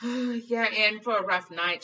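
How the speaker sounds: loud, slow, ominous warning; stern nurse. A narrator's reading. Nurse warning Harry of a rough night, ominous. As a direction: warn him of the pain ahead slowly. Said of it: "she said".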